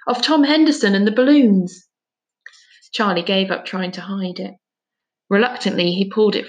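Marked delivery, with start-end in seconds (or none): 0.1-1.9 louder accusing finish; accusingly. A narrator's reading. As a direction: drive the accusation home. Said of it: "she said accusingly".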